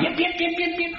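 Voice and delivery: high thin yaps like a little dog; squeaky little dog yips, soft; dog yipping. Retold or imitated. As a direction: yap like a tiny lapdog. Imitating a yapping dog.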